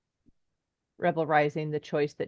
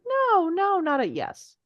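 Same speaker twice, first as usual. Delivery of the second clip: high, loud teasing no; playful denial; 13 semitones higher, 5 dB louder, slower than usual. Retold or imitated. Acting own playful denial.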